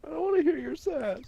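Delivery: high squeaky mocking voice. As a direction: mimic him in a squeaky high voice. Mocking his brother's protest.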